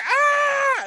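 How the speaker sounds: short, loud high-pitched scream; child's scream. Acted. Game master as two little kids screaming at the climber, high scream. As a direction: scream like a panicked little kid. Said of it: "the little kids are screaming".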